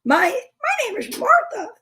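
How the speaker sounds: a failed parrot squeak; attempted parrot voice. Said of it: "I can't really do a parrot voice".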